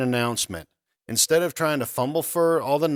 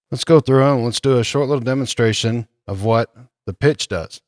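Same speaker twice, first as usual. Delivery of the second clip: usual speaking voice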